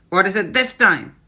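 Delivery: Grand High Witch shouting at the door; impatient witch shout. Acted. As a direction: yell through the door, fed up. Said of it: "shouted the Grand High Witch".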